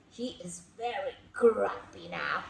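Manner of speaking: plain reading voice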